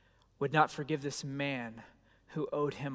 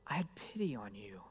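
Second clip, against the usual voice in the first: hushed, lower whisper of hurt; 6 semitones lower, 9 dB quieter than usual. Acted. King whispering to the unmoved servant, softly hurt. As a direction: breathe it out like a grieved confession.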